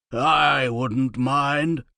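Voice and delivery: slow, careless aside; a noble speaking without thinking; muttering lord. A narrator's reading. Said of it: "realize people could hear him".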